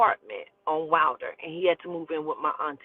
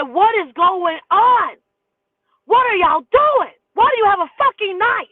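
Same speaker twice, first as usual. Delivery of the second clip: retold scream; 13 semitones higher, 10 dB louder than usual. Screaming at the roommates about the knife, horrified. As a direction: scream it, horrified, drawing each word out.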